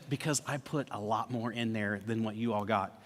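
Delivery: low, very soft confession; confiding hush. Retold or imitated. Husband confides the extra money to his brother-in-law, hushed. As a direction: let him in on the secret quietly.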